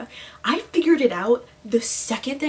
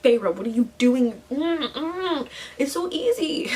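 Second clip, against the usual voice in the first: louder exasperated scold, disapproving hums; a lightly hammed-up fed-up reader; 4 semitones higher, 4 dB louder, slower than usual. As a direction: plead with a dumb heroine in mock despair. Frustrated at the heroine.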